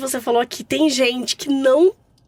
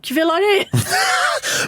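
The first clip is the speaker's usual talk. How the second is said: high, drawn-out mock-fearful question; scared to death; 8 semitones higher, much slower than usual. Mother-in-law asks strangers whose wake it is, mock terrified. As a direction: squeal it out in comic dread. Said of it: "Morrendo de medo".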